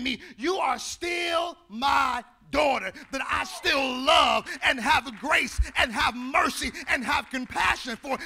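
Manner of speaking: loud, slow, shouted preaching over music; full-force preaching. Acted. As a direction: pour grace out on the people.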